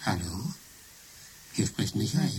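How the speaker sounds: a quiet, lightly voiced fake Michael; computer faking Michael. Computer answers the phone pretending to be Michael, quietly. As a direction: pass yourself off as him, softly. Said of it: "Ich kann deine Stimme imitieren".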